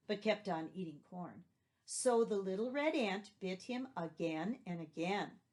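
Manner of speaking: plain reading voice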